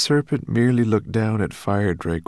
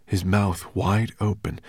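usual speaking voice

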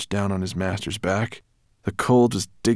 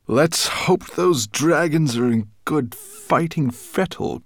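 slow, loud growl, pitched up; Nettlebrand growling; 8 semitones higher, 5 dB louder than usual. A narrator's reading. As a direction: growl it out slowly with menace. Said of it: "growled Nettlebrand".

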